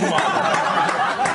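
airy burst of laughter; real laugh. Real, not acted. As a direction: break up laughing. Laughing at own story.

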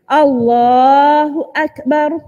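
Allahu Akbar slow with Allah held long; correct takbir. Shown as a demonstration. Teacher repeats the proper takbir. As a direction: hold Allah, keep Akbar short.

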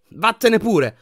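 high, loud burst; angry bawl. A narrator's reading. Harry yelling at the empty frame, furious. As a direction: yell it after someone leaving. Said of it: "sbraitò Harry".

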